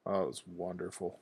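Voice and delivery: ordinary talk, nothing marked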